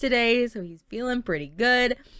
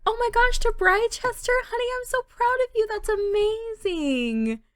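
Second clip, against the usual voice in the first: much higher, loud and gushing; 4 dB louder than usual. Acted. Rachel congratulating Diana, high and gushing. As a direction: squeal it like a proud friend.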